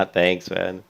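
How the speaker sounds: talking through a swollen mouth; words thick from a swollen mouth. Real, not acted. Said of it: "my mouth is swollen really badly".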